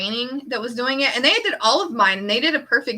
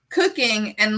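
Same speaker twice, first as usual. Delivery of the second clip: ordinary talk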